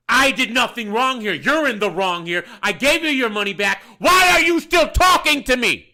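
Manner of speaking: loud argument building to a high shout; angry rebuttal. Retold or imitated. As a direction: argue back hard, exploding on the final question. Acted quarrel with angry customer.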